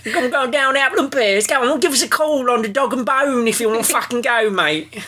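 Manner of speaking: high, hammed-up Cockney with rhyming slang; exaggerated Cockney geezer, cheeky and up for a fight; Cockney accent. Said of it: "Is that your Cockney accent?".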